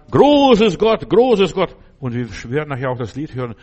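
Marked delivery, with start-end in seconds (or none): 0.1-1.8 sehr hoch und laut, überwältigt ausgerufen; ekstatischer Anbetungsruf. Retold or imitated. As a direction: juble Gott zu wie ein Bekehrter. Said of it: "gehen auf die Knie und beten an". (ekstatischer Ruf der bekehrten Stammesleute)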